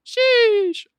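high-pitched joking squeal; squeaky high voice. Pretend.

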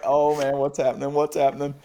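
acting out an urgent yelled question; retold yelling. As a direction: call out in alarm, only half-shouted. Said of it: "So I'm yelling, I'm yelling".

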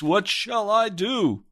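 high anguished cry, slow and drawn out. A narrator's reading. Pilgrim in rags crying out in anguish, high and slow. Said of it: "he cried out in a loud voice".

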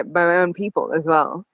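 usual speaking voice

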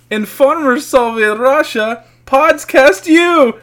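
high, slow, loud Russian joke voice; Russian accent. Pretend. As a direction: drag out the Soviet punchline.

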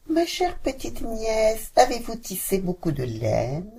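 witch calling sweetly. Acted. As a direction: coax the niece from the doorstep. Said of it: "elle appelait".

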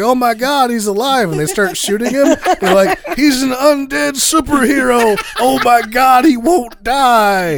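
high, loud hammed-up terror; mock panic. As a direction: shriek like a panicked gunman. Panicked gunmen in car chase.

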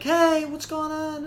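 high, slow, innocent voice; innocent girlfriend voice. Retold or imitated. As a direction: play it sweet and innocent. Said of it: "she's like".

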